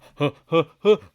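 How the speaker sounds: rising, loud series of gasps; hammed-up gasping build; mock gasps. Acts amazement at the chart.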